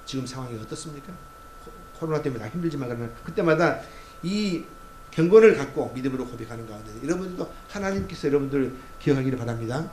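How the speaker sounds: ordinary talk, nothing marked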